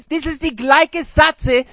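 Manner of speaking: ordinary delivery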